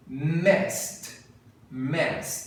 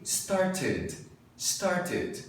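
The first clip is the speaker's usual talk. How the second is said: British started with a crisp, clearly sounded T; British accent; 3 semitones higher, 3 dB quieter than usual. Shown as a demonstration. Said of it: "fala o D e o T bem bonitinho".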